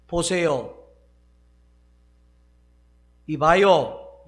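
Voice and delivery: loud call to get attention. Retold or imitated. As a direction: raise the voice to stop the talkers. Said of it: "이렇게". (크게 불러 세우듯)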